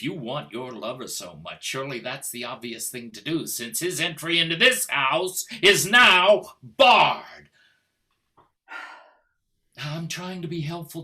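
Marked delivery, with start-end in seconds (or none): 3.5-7.5 loud forbidding. Acted. Husband forbids lover.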